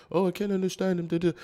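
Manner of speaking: high whiny imitation of a complaining American; mocking American complaint. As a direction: mimic a fussy listener. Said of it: "Americans back home complain about".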